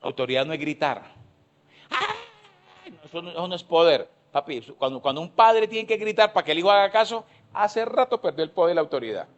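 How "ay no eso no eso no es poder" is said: high falsetto "Ay" mocking a weak parent; squeaky mocking whine. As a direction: parody a powerless shouting parent.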